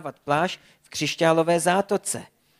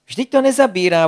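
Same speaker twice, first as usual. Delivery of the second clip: higher, louder worried call; trapped beaver calls out that the jokes are not working; 5 semitones higher, 6 dB louder than usual. Acted. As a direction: call out in worry as the water nears. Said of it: "volal Hryzal".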